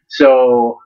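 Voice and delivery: usual speaking voice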